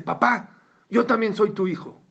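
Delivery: hurt son crying out to his father; angry plea. Retold or imitated. Rich son bursting out at his dying father, loud and hurt.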